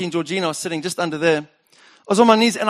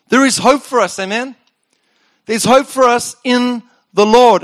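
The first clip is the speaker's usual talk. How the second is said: higher, louder uplifting proclamation; rising hope; 4 semitones higher, 5 dB louder than usual. Acted. Pastor assuring the church, uplifting proclamation. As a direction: lift them with hope. Preaching lift.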